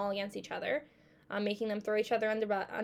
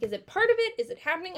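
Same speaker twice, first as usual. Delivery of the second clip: confused disbelief; 8 semitones higher, 5 dB louder, faster than usual. Retold or imitated. Watching the video, baffled at what is happening, high and bewildered. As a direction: pitch jumps way up in bewilderment.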